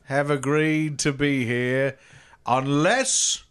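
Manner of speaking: Robin Leach. Pretend. Kleptomaniac Robin Leach greeting the host, low slow put-on voice. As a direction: play a smug TV host.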